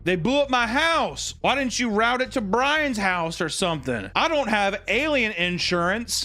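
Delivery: high, aggrieved imitation; mock indignant complaint. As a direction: play up the outrage for laughs. Acting out [name]'s funny complaint.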